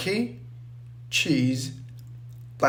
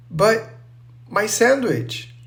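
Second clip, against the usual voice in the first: pitched up in mild dismay; a touch of high-voiced heartbreak; heartbroken; 7 semitones higher than usual. A narrator's reading. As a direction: plead mildly for the sandwich, higher voice.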